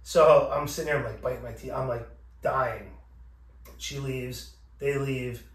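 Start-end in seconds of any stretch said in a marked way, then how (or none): none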